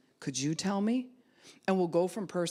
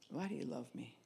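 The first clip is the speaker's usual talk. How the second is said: soft sincere question; 3 semitones lower, 11 dB quieter than usual. Shown as a demonstration. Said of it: "And don't do it like".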